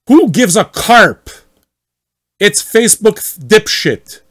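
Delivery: hammed-up angry member ranting at the admin; mock outrage. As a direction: spit it out like an offended poster. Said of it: "read as much as possible like him".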